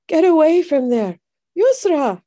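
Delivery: high, loud warning cry; father's cry. A narrator's reading. Father calling his daughter away from danger, urgent cry. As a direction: cry out the warning, pitched well up. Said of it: "her father cried".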